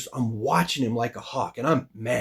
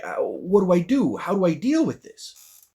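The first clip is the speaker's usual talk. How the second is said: higher, drawn-out retold frustration; exasperated; 5 semitones higher than usual. Fighter retelling a fight he can't figure out, exasperated. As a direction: act out being at wits' end. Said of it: "it's driving me nuts".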